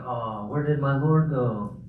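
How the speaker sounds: slow, drawn-out sarcastic lament; feigned bafflement in a put-on voice; mock puzzlement. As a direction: pretend your god has gone missing. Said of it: "kind of using sarcasm".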